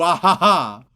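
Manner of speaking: a theatrical villain's ha ha ha; mock villain laugh. Podcast host ends his hypnosis bit with a villain laugh.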